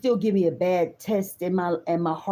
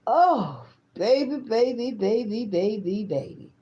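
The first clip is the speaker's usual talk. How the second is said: swooning; 3 semitones higher than usual. Real, not acted. Swooning over him.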